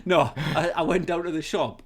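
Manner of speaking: laughing. Real, not acted. Laughing at own joke.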